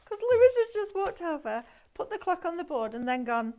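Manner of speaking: helpless laughter through the words, high-pitched; real giggling fit breaking into a high, loud squeal; laughing. Real, not acted. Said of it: "Sorry, I'm laughing".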